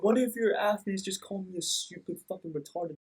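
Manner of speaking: understated imitation of sobbing; mock tearful complaint. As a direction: retell the insult like a hurt man. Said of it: "bawling his eyes".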